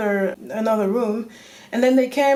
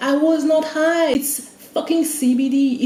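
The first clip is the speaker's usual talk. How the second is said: indignant; 6 semitones higher, 5 dB louder than usual. Real, not acted. Indignant retelling.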